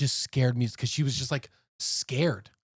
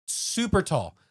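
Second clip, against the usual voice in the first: normal talking voice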